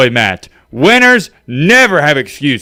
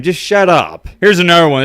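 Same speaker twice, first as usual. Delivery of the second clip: ordinary delivery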